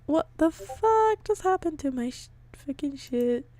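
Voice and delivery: baffled at the broken stream; alarmed. Real, not acted. Stream breaks mid-reading.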